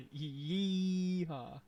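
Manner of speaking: high drawn-out yee-haw; cowboy. Acted. Streamer playing cowboy for laughs, long high whoop. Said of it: "cowboy mode engaged".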